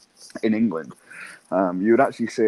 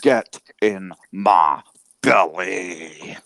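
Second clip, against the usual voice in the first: very slow, louder mock Scottish growl; Fat Bastard voice; 5 dB louder, much slower than usual. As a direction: savour each word like Fat Bastard. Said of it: "fat bastard".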